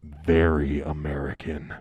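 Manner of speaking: "very American" low and very drawn out; "very American" as a loud, low, slow caricature; mock-emphatic AMERICAN. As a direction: drag "very American" down deep and slow.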